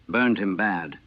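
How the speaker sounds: usual speaking voice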